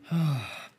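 long weary sigh. Real, not acted. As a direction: heave a resigned sigh. Sighs over argument and software.

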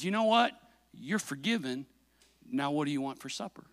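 gentle forgiveness. Retold or imitated. Dad forgiving his son over the gun, gentle and hushed. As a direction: let him off quietly, as Dad.

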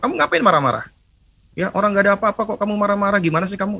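mock scolding. Parent snapping back at child.

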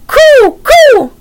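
very high, loud coo; imitated bird cooing at full pitch; loud bird call. A narrator's reading. Teddy addressing the fallen raven in loud coos. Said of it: "said loudly".

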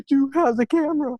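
high-pitched mock pestering; annoying nagging. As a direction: imitate a caller badgering with the same question. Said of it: "kinda getting annoying".

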